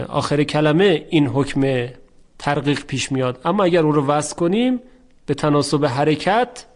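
usual speaking voice

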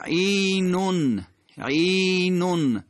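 عینون high and slow, "ʿīīī-nun"; elongated vowel. Shown as a demonstration. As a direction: stretch the first vowel of عینون. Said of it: "عینون حرکت کشیده هست".

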